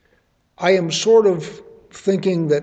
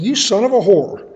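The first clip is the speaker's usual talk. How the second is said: blunt crude paraphrase; 4 semitones higher, 5 dB louder than usual. Acted. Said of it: "Bible speak for saying".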